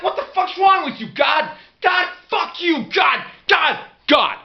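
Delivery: yelling up at God in rage; furious shout. Retold or imitated. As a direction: scream your fury at the sky. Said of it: "I'm like".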